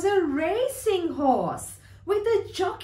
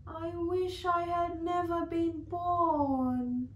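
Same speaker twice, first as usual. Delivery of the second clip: soft, despondent horse; quiet, understated despair; sighing; 6 dB quieter than usual. Acted. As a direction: sigh it out forlornly. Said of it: "Sugar Lump sighed".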